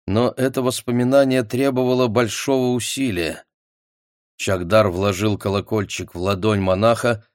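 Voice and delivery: plain reading voice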